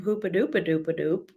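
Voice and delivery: normal talking voice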